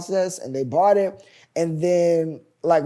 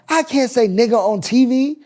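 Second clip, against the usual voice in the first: mock outraged protest, high and loud; retold loud objection; 7 semitones higher, 7 dB louder than usual. Comedian objects to the writer's script, retold in a loud high protest. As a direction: imitate her objecting, pitched up and loud.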